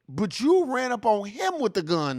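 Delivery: normal talking voice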